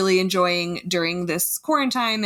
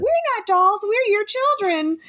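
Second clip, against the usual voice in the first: high, calm doll voice; too calm creepy doll; 14 semitones higher than usual. Retold or imitated. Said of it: "and then in the doll voice".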